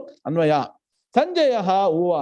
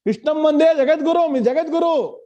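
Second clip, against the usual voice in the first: emphatic praise; 7 semitones higher, 5 dB louder than usual. Real, not acted. Praising Krishna as world teacher.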